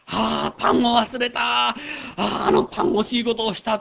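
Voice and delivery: lightly hammed-up fussing about forgotten bread; fretting gentile. Pastor mocking disciples fretting over bread, hammed-up lament. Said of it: "やっぱり異邦人と同じように".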